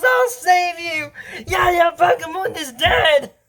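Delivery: high, drawn-out dramatic shout; mock heroic cry. Rescuer calls as friend falls, high mock cry. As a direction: call out long and high over the action. Shouting during the gateway fall.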